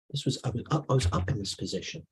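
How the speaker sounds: ordinary talk, nothing marked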